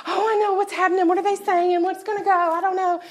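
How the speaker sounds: loud, high-pitched mock fretting; anxious fretting. Speaker mimics people who stir up the noise, hammed-up fretting. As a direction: imitate people stirring up alarm.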